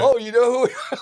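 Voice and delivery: imitating a thrilled musician, high and loud; flattered surprise. Band singer to a fan who recognized him, mock flattered surprise. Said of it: "he did, once again".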